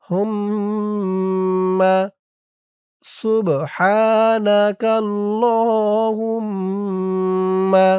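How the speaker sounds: হুম্মা held with a humming nasal m; slow recitation, humming ghunnah on the double m. Shown as a demonstration. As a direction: hum the মিম in সুবহানাকাল্লাহুম্মা before the vowel. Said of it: "গুন্না হবে, ওয়াজিব গুন্না".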